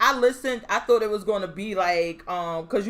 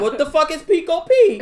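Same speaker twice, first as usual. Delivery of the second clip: rising, much louder laughing shout; incredulous shout; 6 semitones higher, 10 dB louder than usual. Retold or imitated. Calling out Taylor over her son's words, loud incredulous shout. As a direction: shout it in disbelief. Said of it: "I'm like".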